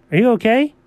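usual speaking voice

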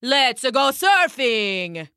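very slow, drawn-out call to surf; rally everyone; hammed-up rallying shout. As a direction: Dracula inviting all to the waves. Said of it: "he shouted".

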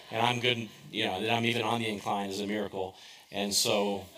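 ordinary talk, nothing marked

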